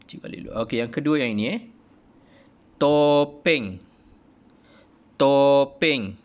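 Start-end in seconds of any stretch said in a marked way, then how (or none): 2.8-6.2 "Topeng" loud and high, falling; modelled word, said twice. Shown as a demonstration. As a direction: sebut "Topeng" dengan kuat dan jelas. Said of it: "Boleh ulang?".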